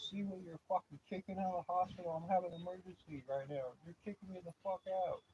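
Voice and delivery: high, loud shout through the window; hammed-up yell at the nurses, pitched high; retold furious shout. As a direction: rage at the staff who kicked him out. Shouting at emergency window staff.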